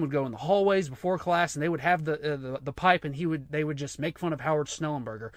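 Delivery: ordinary delivery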